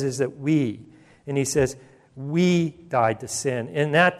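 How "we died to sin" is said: slow, drawn-out stress on "We"; emphatic WE. Shown as a demonstration. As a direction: lean slowly on "We" in the quoted verse. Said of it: "too, is emphatic".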